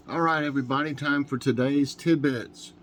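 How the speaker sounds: upbeat announcer intro. Acted. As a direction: announce the segment brightly. Segment announcement.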